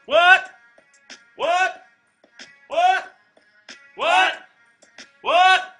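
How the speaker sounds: shouted what. Acted.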